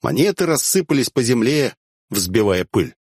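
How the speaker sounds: plain reading voice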